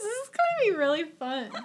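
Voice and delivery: put-on overexcited kid begging to show off; mock eager show-off. Mocking herself as a host eager to show off impressions. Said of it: "my cool Skyrim impressions".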